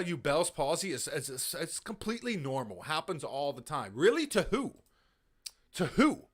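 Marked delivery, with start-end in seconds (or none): 3.9-6.2 sharp higher, louder disbelief; mocking indignant disbelief; incredulous. Real, not acted. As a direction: scoff at it as if it's absurd.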